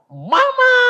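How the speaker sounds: very high, loud cry for mother; acted child's yell; child's shout. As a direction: shriek it like a child calling out. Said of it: "Anaknya teriak".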